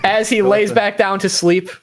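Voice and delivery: normal talking voice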